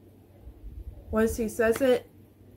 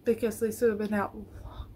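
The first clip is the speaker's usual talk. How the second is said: trailing into a tearful whisper, much softer; words fading as tears come; 6 dB quieter than usual. Real, not acted. Vlogger waiting on Lisa's verdict, holding back tears. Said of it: "Why am I already crying".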